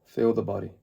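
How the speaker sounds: calm meditation guide, long pauses between phrases; guided meditation. Acted. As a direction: ease them into the present moment, unhurried.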